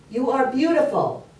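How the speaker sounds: acted dad calling to the echo; father calling out. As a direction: call the praise out to the forest. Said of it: "And his dad said".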